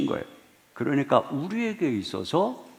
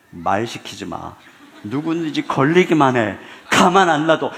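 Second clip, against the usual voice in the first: mock grumpy threat; 7 dB louder than usual. Tired parent's voiced threat.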